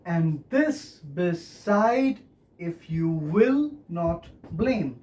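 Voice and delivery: very slow, four measured accents; slow demonstration of the metre, beat by beat; scanned verse. Shown as a demonstration. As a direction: stretch it out accent by accent. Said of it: "So you have four accents".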